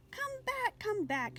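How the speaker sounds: high calling cry after him. A narrator's reading. Lamb calls after the departing butterfly, a high pleading cry. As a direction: call out high as he leaves. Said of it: "she cried".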